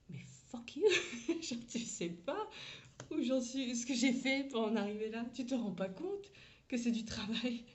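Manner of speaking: high, loud mock outrage breaking into laughter; indignation jouée. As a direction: play up the indignation, laughing through it. Mock outraged reply to colleague. (fausse indignation, voix haute, en riant)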